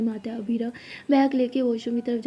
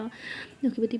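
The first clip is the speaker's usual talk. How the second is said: ordinary delivery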